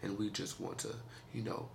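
low, soft radio-host voice; deep sexy voice. Acted. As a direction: purr it like a late-night DJ. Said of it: "in my deep, sexy voice".

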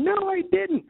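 put-on childish stomping denial. Boy stomping his foot at the accusing kids, high protest. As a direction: plant your foot and insist like a six-year-old. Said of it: "was stomping on my foot going".

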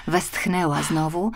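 plain reading voice

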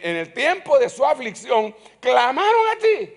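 loud, high preaching cry; preaching at full force. Acted. Preacher crying out the verse to the congregation, loud and high. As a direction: ring out the verse.

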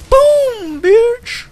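very high, loud victory yell; triumphant shout. Real, not acted. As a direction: scream it after the kill. Celebrating a kill.

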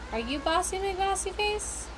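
high, playful taunt; teasing question. Acted. Gamer teasing cat named Bossy McBossy Face, high and playful.

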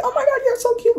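very high excited gush; match her squeal up high; gushing back in a high squeal. Retold or imitated. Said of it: "it literally sounds like mirrored voices".

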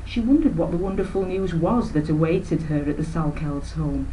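ordinary delivery, nothing marked

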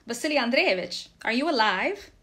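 shouted question through a storm; calling out. A narrator's reading. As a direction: call across a distance to check on someone. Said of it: "he called out".

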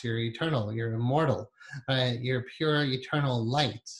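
ordinary talk

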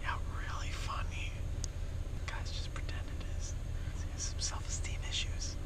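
whispered sarcastic aside. Real, not acted. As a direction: mutter it under your breath to camera.

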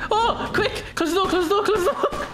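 high, laughing shout; laughing panic. Real, not acted.

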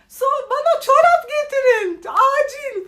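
very high, very loud urgent yell; urgent shout. Retold or imitated. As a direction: scream the order like an emergency. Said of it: "bağırıyorsunuz restoranlarda".

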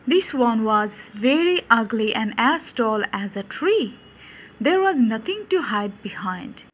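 ordinary delivery, nothing marked

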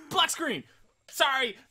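loud high shouts; shouting. Real, not acted. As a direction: yell it out.

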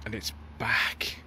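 slow, hushed whisper; a slow dramatic whisper to the viewers; whispered reveal. Acted. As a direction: whisper it like a secret comeback. Dramatic reveal of spotted car.

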